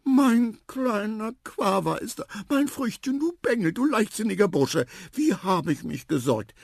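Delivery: high, emotional fussing voice; overjoyed, fretting mother elephant. A narrator's reading. Mother to her returned son, high relieved fussing. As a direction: gush and scold at once, starting high and easing down.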